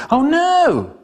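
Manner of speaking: high-pitched mock alarm; mock dismay. Storyteller reacting to the bombs, mock dismay. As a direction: wail it in hammed-up dismay.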